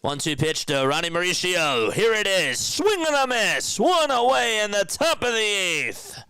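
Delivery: high, loud fan calling the strikeout; rising to a loud high call of the swing and miss; excited play-by-play. Real, not acted. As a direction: call it like a thrilled announcer.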